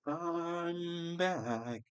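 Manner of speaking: high, drawn-out catchphrase impression; Joey's World Tour. Streamer returns with a YouTuber's catchphrase, high drawn-out impression. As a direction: hold it long and high, then drop on "back". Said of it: "That's what Joey's World Tour used to say".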